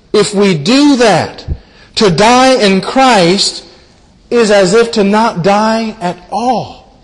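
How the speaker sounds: slow, weighty, each phrase spaced; slow, deliberate sermon emphasis. Real, not acted. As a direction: lean on every phrase slowly. Preacher slows for emphasis.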